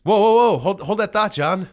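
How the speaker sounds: loud, high call to stop; whoa, break in loudly to halt him; a sudden loud alarm-call to hold everything; excited interruption. Real, not acted. Interrupting to announce a guest.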